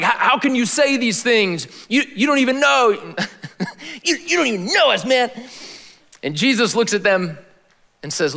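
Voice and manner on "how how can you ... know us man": higher, incredulous leader voice with a laugh; mocking indignant leaders. Preacher voicing the religious leaders scoffing at Jesus, higher and incredulous. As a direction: scoff at him like an offended leader.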